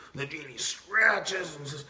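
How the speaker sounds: ordinary talk, nothing marked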